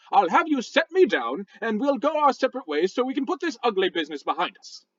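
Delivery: huffy posh British rock voice; indignant British gentleman scolding; a rock with a stuffy British accent; angry British. Acted. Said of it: "angry British sounding talk".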